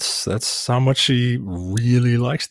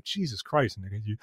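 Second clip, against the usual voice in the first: retold muttered exasperation, trailing off; exasperated aside; 3 semitones lower, 12 dB quieter than usual. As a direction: mutter it softly under your breath. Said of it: "It's like".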